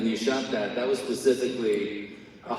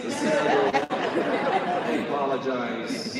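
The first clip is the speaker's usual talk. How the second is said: ordinary delivery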